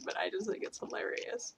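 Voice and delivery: whispered aside, unvoiced. Real, not acted. Friend whispers about funny Canadians, unvoiced. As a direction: let it slip out under your breath. Aside said under her breath.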